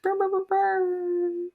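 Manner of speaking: high slow buzzing brr sounds; chainsaw buzz. Pretend. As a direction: rev it like a chainsaw. Buzzing noise in podcast intro.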